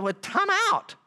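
high-pitched interjection of disbelief; imitating an objector halting the argument; exasperated Paul. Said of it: "Paul's like".

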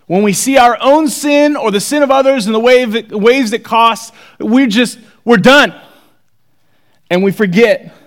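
fiery sermon climax; preaching at full force. Acted. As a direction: build the appeal to a near-shout.

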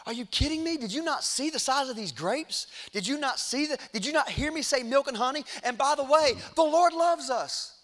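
high-pitched, disbelieving appeal; incredulous pleading. Retold or imitated. Caleb challenging the people who doubt, incredulous and high. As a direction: plead as if they missed the obvious.

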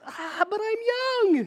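high, slow whine of an excuse; whiny excuse. Retold or imitated. Preacher mocking his own excuse to God in a high whine. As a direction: whine it out like a reluctant kid. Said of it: "here's my words".

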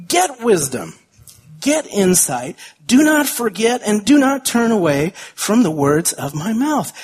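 emphatic, slowed Bible reading; scripture reading. Acted. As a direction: proclaim the verses with conviction. Reads scripture with fervor.

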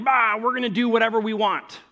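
hammed-up rebellious yell thrown at God; mock defiant shout. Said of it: "shout at him".

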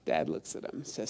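normal talking voice

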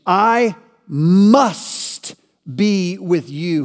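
Jesus refusing to be put off, intense. Retold or imitated. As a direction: insist on every word. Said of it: "certainly with intensity".

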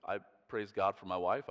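usual speaking voice

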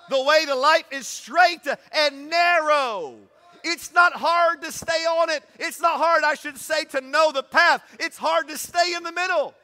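loud, high preaching with shouted peaks; full-force preaching. Acted. Pastor driving the race rules home to church, shouting preaching. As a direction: preach it hard, building to the shout.

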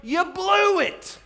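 high, drawn-out accusing shout; preacher's full-force acted rebuke; Peter accusing the crowd. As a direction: shout it slowly at the guilty crowd. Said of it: "This is Peter looking at these people going".

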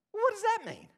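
baffled crowd; puzzled. Acted. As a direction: ask in confusion. Listeners puzzled.

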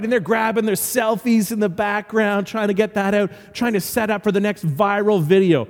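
ordinary talk, nothing marked

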